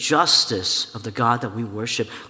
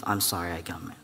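put-on quiet child apology; 8 semitones lower, 8 dB quieter than usual. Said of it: "And he says".

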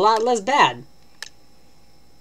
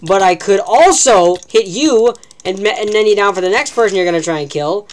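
mock whiny enemy; 4 semitones higher, 10 dB louder than usual. Gamer mocking an enemy player's logic, loud and whiny. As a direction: parody the other player begging. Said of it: "and you're just like".

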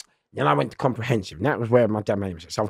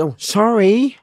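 a hint of a meek fool, much higher; playing dumb; 11 semitones higher, 5 dB louder, slower than usual. Acting dumb when inmates kick his heels, meek. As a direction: act the harmless peanut. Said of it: "So I'm playing Sammy".

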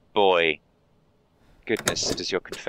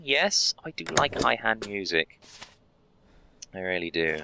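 ordinary talk, nothing marked

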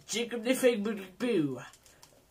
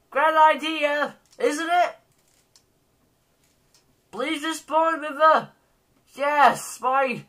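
higher, louder begging the game; pleading with the frozen game, higher and louder; 6 semitones higher, 4 dB louder than usual. Acted. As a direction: plead with the crashed game to come back.

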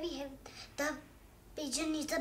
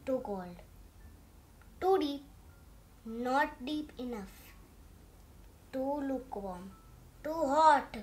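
ordinary delivery, nothing marked

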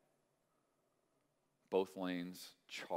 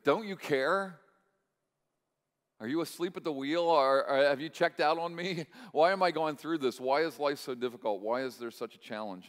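loud, higher, exasperated questions to God; frustrated plea; 6 semitones higher, 7 dB louder than usual. Retold or imitated. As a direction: cry out as if abandoned, easing off at the end. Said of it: "It's like".

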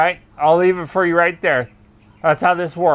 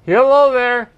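louder raised call; 5 semitones higher, 4 dB louder than usual. Real, not acted. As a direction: call up toward the house. Calling out at a house.

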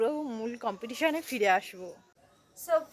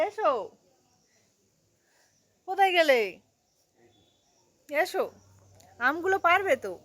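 calling out; 4 semitones higher, 5 dB louder than usual. Real, not acted. Calling husband on the roof.

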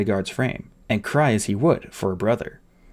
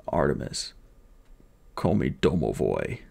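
much lower, softer, fading strength; a strong man's last weak words; comforting the boy while dying; dying bodyguard; 9 semitones lower, 6 dB quieter than usual. Acted.

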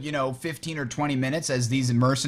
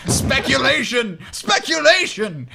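loud, slow, drawn-out exclamation; dramatic shout; 8 semitones higher, 5 dB louder, slower than usual. Pretend. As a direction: proclaim it like a dramatic accusation. Mock-dramatic cry of speculation.

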